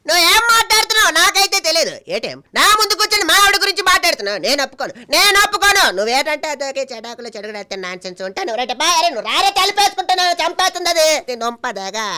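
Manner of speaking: very high, loud, agitated comic mimicry; Kondavalasa. Shown as a demonstration. Comedian accused of talking about his wife, high indignant outburst. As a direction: squawk it out indignantly, far above your own pitch. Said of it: "ఆయన ముందు కూడా నేను చేశాను".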